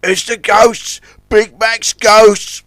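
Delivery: high, loud cry of alarm; Zoran crying out. A narrator's reading. Zoran sees the ghost tugs, crying out. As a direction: wail it out, drawing out the last words. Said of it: "cried Zoran".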